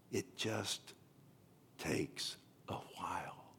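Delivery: quiet, slow whisper; soft whisper. Retold or imitated. Pastor's lesson to Corrie, whispered. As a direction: breathe the words out softly.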